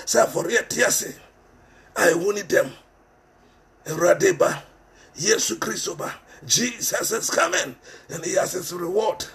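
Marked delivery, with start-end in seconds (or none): none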